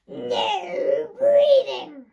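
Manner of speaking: very slow, drawn-out loud shout; strict teacher shout. Retold or imitated. Teacher roaring at the class, slow and loud. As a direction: roar it like a tyrant teacher. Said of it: "once more, from the beginning".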